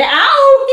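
high, loud cartoon "ow"; long high howl of pain, hammed up; pained yelp. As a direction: yelp as if sharply scratched. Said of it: "he cried".

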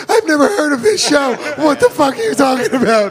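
high, tearful bewildered protest; hammed-up panicky whine; mock distraught victim. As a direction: imitate a frightened person near tears. Acts scared victim answering the host.

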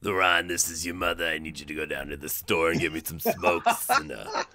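put-on croaky old mother asking for smokes; old lady voice. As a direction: play a demanding old mom. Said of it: "you get the old lady voice".

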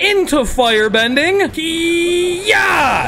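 hammed-up martial arts kiai; building battle cry. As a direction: build to a karate yell. Building to attack shout.

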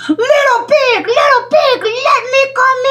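sing-song wolf at the door. Acted. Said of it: "he cried".

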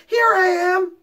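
high, loud answering call; calling back. Acted. Stuart calling back from inside the shade, high loud call. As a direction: shout back so they can hear. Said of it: "called back".

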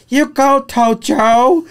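high-pitched imitated old lady cheering; elderly lady. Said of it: "there was an elderly lady".

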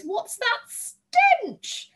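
very high, loud, drawn-out disgust; high girlish horror at a stink; revolted outcry. A narrator's reading. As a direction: wail it slowly as if pinching the nose. Girl pinches nose at smell.

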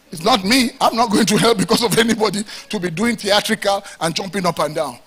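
loud, driving sermon; preaching at full force. Acted. Pastor warning the church against theatrics, loud preaching. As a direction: preach it hard to the congregation.

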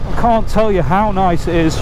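usual speaking voice

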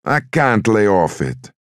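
high, slow, drawn-out protest; distraught mother. A narrator's reading. Mother refusing her son's plea, distraught. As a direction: protest high, dragging the words.

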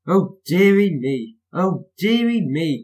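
high, slow, drawn-out lament; groaning dismay. A narrator's reading. Thomas seeing Bertie cross the bridge, groaning. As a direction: stretch it into a storybook groan. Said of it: "groaned Thomas".

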